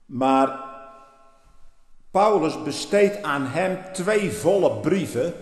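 ordinary talk, nothing marked

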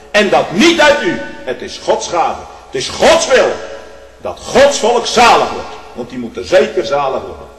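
loud, high pulpit climax; loud sermon peak; preaching at full force. Acted. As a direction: thunder it from the pulpit.

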